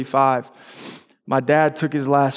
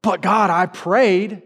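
high, slow, whiny plea dragged out; pleading to God like a sulking kid; mock tantrum; 6 semitones higher, much slower than usual. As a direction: play a hurt child stretching the words. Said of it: "You throw a tantrum".

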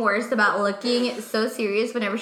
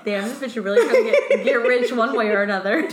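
amused disbelief; 5 dB louder than usual. Retold or imitated. Inner reaction to friend's answer.

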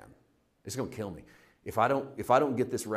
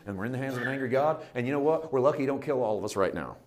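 ordinary delivery

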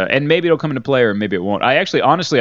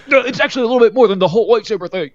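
high, fast whiny nerd rant; toxic fan voice; 11 semitones higher, 3 dB louder, much faster than usual. Retold or imitated. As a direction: squeal it out like an outraged fanboy. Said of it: "They're like".

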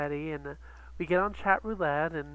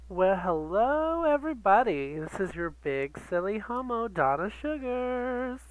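breathy vintage purr; 5 semitones higher than usual. Acted. Drag persona show greeting.

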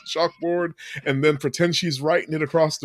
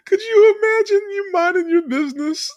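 incredulous laughing rant; 3 dB louder than usual. Real, not acted.

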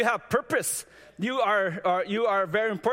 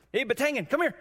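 high, friendly call of the name; coworker's greeting; 7 semitones higher than usual. Retold or imitated. Coworker calling him over in the hallway, high. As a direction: call out his name like a buddy. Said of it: "That's my last name".

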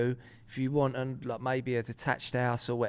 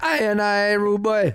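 hammed-up Jamaican rude boy accent, louder and higher; Jamaican patois; 6 semitones higher, 7 dB louder, slower than usual. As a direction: play a mock Jamaican patois voice box. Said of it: "to be like Jamaican patois".

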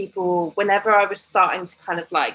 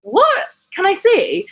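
very high, louder imitated surprise; surprised; 5 dB louder than usual. Husband wanting to see her blog, very high and surprised. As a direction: squeak it out in excited disbelief.

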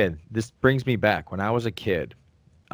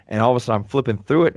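ordinary talk

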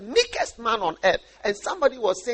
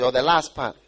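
ordinary talk

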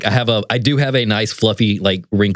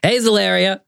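higher, chipper hello; cheery greeting; 10 semitones higher than usual. Acted. Disguised rogue greeting the sister, higher and chipper. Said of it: "It's like".

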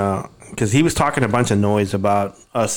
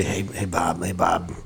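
lower, softer East Coast smoker voice; 5 semitones lower, 6 dB quieter than usual. Retold or imitated. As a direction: rumble it like a heavy smoker. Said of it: "he sounds like a smoker from the East".

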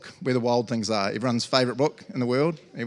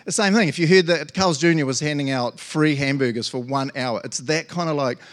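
ordinary talk, nothing marked

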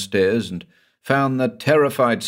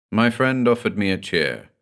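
ordinary delivery

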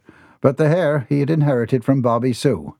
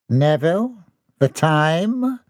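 condescending; 5 semitones higher, slower than usual. A narrator's reading. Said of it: "John's tone was condescending".

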